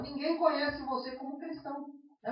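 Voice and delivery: normal talking voice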